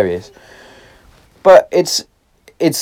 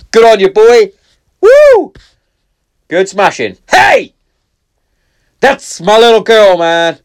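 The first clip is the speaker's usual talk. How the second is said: high, loud hammed-up proud dad; mocking proud dad; 8 semitones higher, 5 dB louder than usual. Mocking a dad watching his daughter, hammed-up cheer. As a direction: cheer like a clueless proud father. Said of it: "some fucking dad is thinking that".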